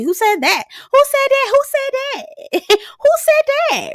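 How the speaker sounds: escalating very high-pitched squeal; mock indignant disbelief. Man denying he is handsy, mock indignant squeal. Imitates Nick's indignant denial, repeated.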